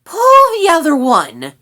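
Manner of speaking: high, loud, scornful rat; squeaky angry retort; venomous retort. A narrator's reading. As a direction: throw it back scathingly. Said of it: "threw Sorrel a venomous glance".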